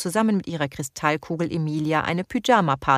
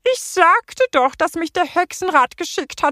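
very high, loud croaking female toad; 11 semitones higher, 5 dB louder than usual. A narrator's reading. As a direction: croak it like a prim toad lady. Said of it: "quakte die Kröte mit einer weiblichen Stimme".

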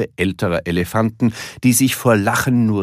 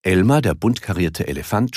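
plain reading voice

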